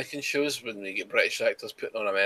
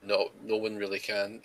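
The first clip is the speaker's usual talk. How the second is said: ordinary talk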